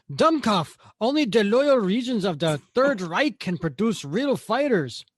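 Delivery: hammed-up phony German accent, high and loud; bad German accent. Costumed soldier posing as a German Nazi, bad German accent. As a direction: bark it out in a deliberately terrible German accent. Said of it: "I gave a really bad German accent".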